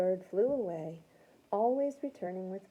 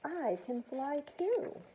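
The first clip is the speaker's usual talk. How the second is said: joyful; 7 semitones higher, slower than usual. Acted. Stella Luna's joyful thought.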